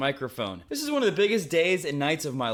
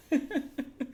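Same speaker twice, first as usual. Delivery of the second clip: high laugh; 12 semitones higher, 3 dB quieter than usual. Real, not acted. Laughing at his own joke.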